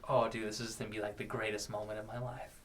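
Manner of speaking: low, soft voice of a private thought; quiet inner thought. Retold or imitated. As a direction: murmur it to yourself. Retold private thought mid-song.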